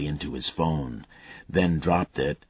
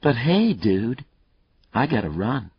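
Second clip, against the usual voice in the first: Hollywood voice; 7 semitones higher, 5 dB louder than usual. Acted. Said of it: "His voice changed again, went Hollywood".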